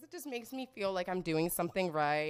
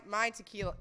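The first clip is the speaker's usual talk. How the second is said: ordinary talk, nothing marked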